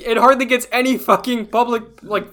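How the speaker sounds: high, loud outraged protest; real indignant rant about funding; heated protest. Real, not acted. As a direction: defend it in real exasperation. Heated NPR argument.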